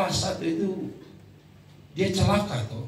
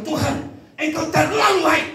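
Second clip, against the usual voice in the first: high, loud mock praise to God; exaggerated praise; 10 semitones higher, 4 dB louder than usual. Shown as a demonstration. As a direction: proclaim God's goodness high and forcefully. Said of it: "itu juga ada jenis-jenis rohani".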